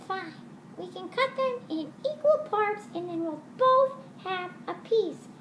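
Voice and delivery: lilting little puppet, half singing; high puppet voice. Acted. Puppet sings along.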